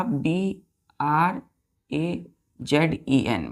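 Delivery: slow spelling. Shown as a demonstration. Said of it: "teacher spells word for children".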